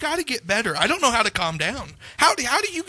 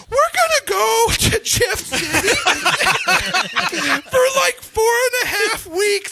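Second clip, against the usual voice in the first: much higher, sing-song imitation of the wife; chirpy, innocent asking; hammed-up high wife voice; mock wife voice; 4 dB louder than usual. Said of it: "then the next day she's like".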